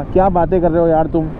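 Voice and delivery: usual speaking voice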